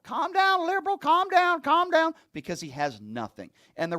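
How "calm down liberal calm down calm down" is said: much higher, louder mock brush-off; mocking James Comer. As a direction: squeal it at the reporter like a cornered politician. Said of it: "He calls a member of the media a liberal".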